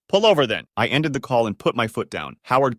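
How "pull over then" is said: raised, loud command; angry order. A narrator's reading. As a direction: bark it back at the other driver. Shouted order in car chase.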